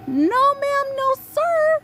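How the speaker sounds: very high, sing-song refusal; mock squeaky refusal. Defiant refusal of sheeple.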